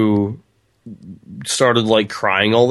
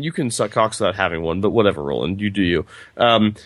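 ordinary talk

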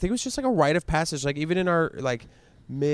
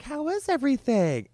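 mom voice; 13 semitones higher than usual. Retold or imitated. Mom asks sweetly, voiced high.

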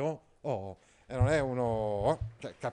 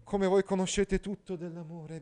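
Priscilla whispering; 6 semitones higher, 8 dB louder than usual. Acted. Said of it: "bisbigliava Priscilla".

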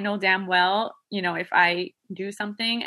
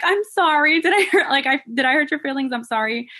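put-on high, loud sing-song sorry to a child; mock sweet apology; 7 semitones higher, 6 dB louder than usual. Mom to crying daughter in the car, high cooing apology. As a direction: coo an apology like to a toddler. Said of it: "I'm looking back and I'm like".

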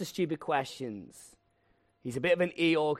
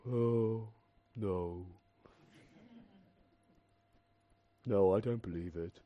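much lower, slow, mopey Eeyore voice; gloomy Eeyore; 6 semitones lower, 6 dB quieter, slower than usual. Retold or imitated. [name] as Eeyore, glumly refusing the news. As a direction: drone it like a sad donkey. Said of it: "'cause he's Eeyore".

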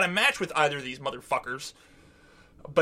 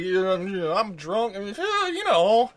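mock drunk; slower than usual. Imitating [name] drunk at the pool party, mock-drunk voice. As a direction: imitate someone many shots in. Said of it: "clearly had a lot of shots".

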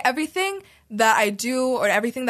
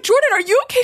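worried call; 10 semitones higher, 5 dB louder, faster than usual. Retold or imitated. Rushing to a screaming friend.

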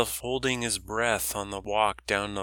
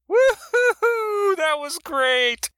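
put-on much higher, louder, slow whooping old woman; a loud old woman hollering praise across the dock; loud raspy old woman; 8 dB louder, slower than usual. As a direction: whoop it out like a brash dock lady cheering. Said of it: "A raspy female voice beside the fuel dock spoke loudly".

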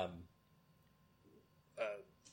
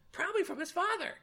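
far higher sniffy mom voice; other mom. Retold or imitated. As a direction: sniff it like a prim mother. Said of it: "she goes".

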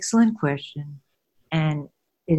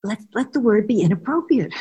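bright and pleased; 5 semitones higher, 5 dB louder than usual. Retold or imitated. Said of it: "and he said".

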